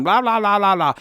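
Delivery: high-pitched silly babbling; playful babble. Retold or imitated. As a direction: imitate a playful pest interrupting work. Imitates wife jumping on him playfully.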